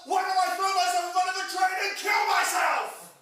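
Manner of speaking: hammed-up melodramatic cry; mock despairing shout. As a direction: yell it out in fake despair. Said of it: "I'd be like".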